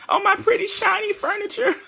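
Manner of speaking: high, loud mock wail for the furniture; mock lament. Mourning her furniture to listeners, high mock wail. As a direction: wail over lost shiny furniture.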